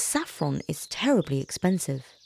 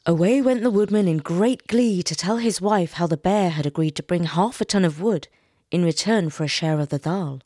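usual speaking voice

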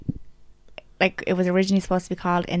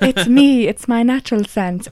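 TV character impression; 4 semitones higher, 7 dB louder, slower than usual. Quoting favourite Friends lines.

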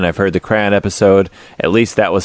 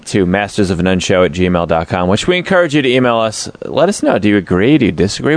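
usual speaking voice

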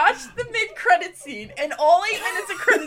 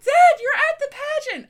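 much higher and louder, gushing mock delight; mock excitement; 11 semitones higher, 5 dB louder than usual. Daughters greeting the dad in the movie, mock gushing. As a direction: squeal it like a cheesy reunion. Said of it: "And they're like".

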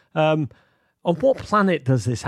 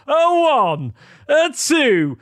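high, loud bandleader count-in; hammed-up count-in; 11 semitones higher, 5 dB louder, much slower than usual. Host leads a mock ballpark singalong, hammed-up count-in. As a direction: belt it out to start the singalong.

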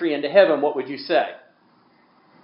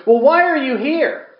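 frustrated angel; 13 semitones higher, 5 dB louder than usual. Acted. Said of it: "in frustration".

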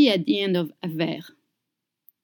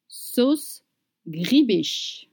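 ordinary talk, nothing marked

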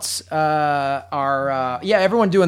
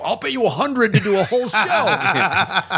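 mock-eager, higher and drawn out; eager listener; 4 semitones higher than usual. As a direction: quote an over-keen fan, half laughing. Quoting eager listener emails, laughing.

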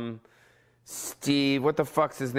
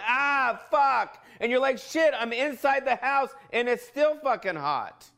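exasperated groan; 10 semitones higher than usual. Retold or imitated. Complaining about heat inside house.

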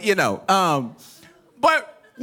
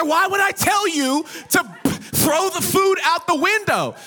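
exasperated shout; 5 semitones higher than usual. Retold or imitated. Mom yelling at dad in the car, exasperated shout. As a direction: yell the question at the husband. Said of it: "She, she said".

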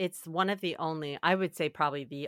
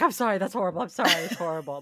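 higher, repeated apologizing; imitated flustered apology; 4 semitones higher, 6 dB louder, faster than usual. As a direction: mimic her rushing to apologize. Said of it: "She immediately apologizes every time".

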